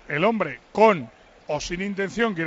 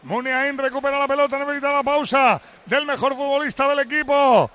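live play-by-play; 7 semitones higher, 5 dB louder than usual. Real, not acted. Commentator seeing Valenciaga's error become a goal, urgent play-by-play. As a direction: build from match call into a goal shout.

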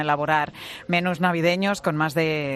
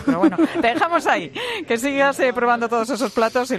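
laughing; 6 semitones higher than usual. Real, not acted. Host laughs at eating guest.

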